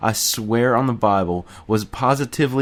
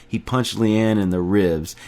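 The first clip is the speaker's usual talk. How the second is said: plain reading voice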